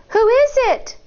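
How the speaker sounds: put-on high, small child asking; a young girl calling through the door; high little-girl voice; child's voice. Child Shang asks through door.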